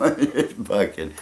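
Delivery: ordinary talk, nothing marked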